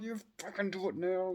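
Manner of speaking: high, pushy put-on demand; impatient Reva. Fan imitating frustrated impatient Reva, high pushy demand. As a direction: bark it like an impatient villain. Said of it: "the way everything that she talks about is like".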